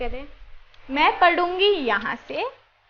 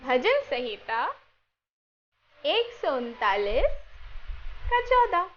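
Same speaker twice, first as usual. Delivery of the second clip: slow verse with long pauses between phrases; slow recitation for echoing; slower than usual. Shown as a demonstration. Teacher reciting a Bible verse for children to echo, slowly. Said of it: "मैं बोलूँगी और मेरे पीछे दोहराना".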